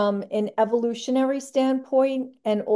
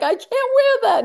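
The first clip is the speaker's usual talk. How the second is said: high-pitched squealed mock protest; appalled protest; 6 dB louder than usual. Retelling Jerry refusing the pirate shirt, squealing in protest. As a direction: shoot way up in pitch, loudly objecting.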